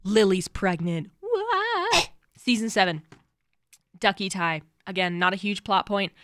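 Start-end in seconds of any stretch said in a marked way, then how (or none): 0.0-2.1 mock shock.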